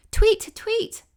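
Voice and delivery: very high, loud chirping; bird chirp. A narrator's reading. Swallow greeting Tiny from above, high chirp. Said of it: "sounded over her head suddenly".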